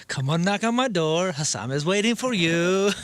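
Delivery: mimicked Spanish accent. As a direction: tease the neighbor with a Spanish accent. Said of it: "Yeah, the accent in the".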